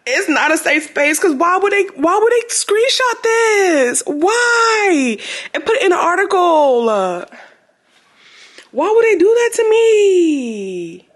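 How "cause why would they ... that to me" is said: high, whiny, drawn-out pleading; mock-crying lament with long stretched endings; wailing protest. Real, not acted. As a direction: plead like you've been deeply wronged, hammed up. Lamenting being screenshotted for an article.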